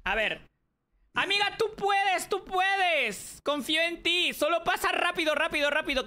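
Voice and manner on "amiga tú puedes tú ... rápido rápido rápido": high-pitched, excited encouragement; urgent cheering. Real, not acted. Coaching her friend through the jump, high urgent cheering.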